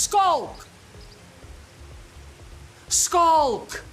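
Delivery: high, loud shout of his name; loud call. Retold or imitated. Fan calls comedian across restaurant, shouting. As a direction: call out to make him turn. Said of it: "this guy goes".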